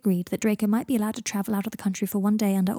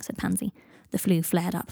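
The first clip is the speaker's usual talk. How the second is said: ordinary delivery, nothing marked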